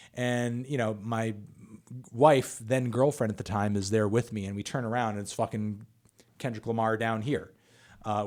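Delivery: ordinary talk, nothing marked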